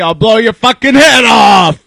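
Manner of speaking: threatening shout. Acted. Acted threat to trespassers.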